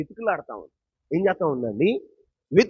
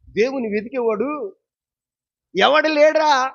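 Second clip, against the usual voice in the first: preaching shout; 8 semitones higher, 6 dB louder than usual. Acted. Preacher quoting scripture loudly.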